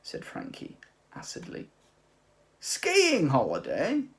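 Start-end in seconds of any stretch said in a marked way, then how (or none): 2.6-4.0 high, loud, slow disbelieving echo; incredulous cry. Acted. Old man outraged by the skiing idea, crying out high. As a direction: repeat it as if it's an insult. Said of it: "cried the old man".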